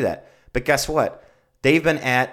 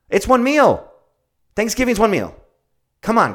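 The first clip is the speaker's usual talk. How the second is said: emphatic shout; 10 semitones higher, 4 dB louder than usual. Real, not acted. Emphatic rant about holiday eating.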